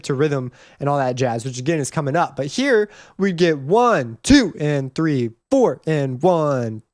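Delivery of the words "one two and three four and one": slow count punching "two" and "four"; stress on TWO and FOUR. Shown as a demonstration. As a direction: hit "two" and "four" as you count slowly. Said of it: "beats two and beats four are not commonly".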